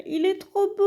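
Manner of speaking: high, delighted cry over a beautiful plant; excited gush. Real, not acted. Gasps at her new plant.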